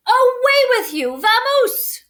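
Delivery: loud, high shooing shout; angry Bear. A narrator's reading. Bear ordering the mouse out, loud shout. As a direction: shoo the mouse out.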